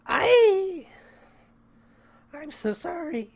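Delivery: put-on squeaky high-pitched girl voice. Shown as a demonstration. Dev testing a girl voice for his game, squeaky and high. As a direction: squeak it out like a timid girl apologizing. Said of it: "I can't do a squeaky, high-pitched girl voice".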